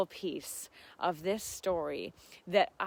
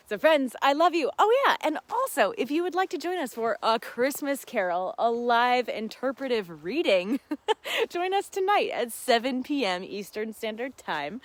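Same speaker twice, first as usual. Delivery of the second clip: excited announcement; 4 semitones higher, 6 dB louder than usual. Real, not acted.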